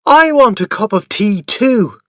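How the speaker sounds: put-on a loud, high child's protest; shouted demand. A narrator's reading. Boy demanding tea like his father, loud. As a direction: demand your share at full voice. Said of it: "I shouted".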